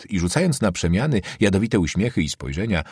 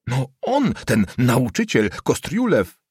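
scornful, pitch raised; contemptuous; 5 semitones higher, slower than usual. A narrator's reading. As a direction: spell out who is meant with disdain. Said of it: "rzekł Figa wzgardliwie". (wzgardliwie, wyższym głosem)

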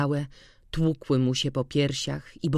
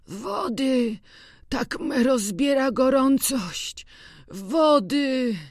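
much higher, strained voice of a feverish farmhand; sick old man begging; 10 semitones higher, 4 dB louder, slower than usual. A narrator's reading. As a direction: plead for water, burning with fever. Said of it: "słabym, schorzałym głosem".

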